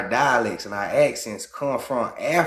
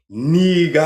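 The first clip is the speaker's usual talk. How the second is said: put-on African accent; 7 semitones higher, 7 dB louder than usual. Mocking how an African man says the word, African accent. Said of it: "The African".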